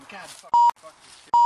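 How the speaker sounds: very high, loud screamed curse; frustrated screaming. Acted. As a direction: explode in rage mid-take.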